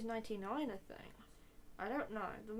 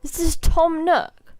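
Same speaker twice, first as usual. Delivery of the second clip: slow yelling; 6 semitones higher, 20 dB louder, slower than usual. Real, not acted. Streamer yells at game.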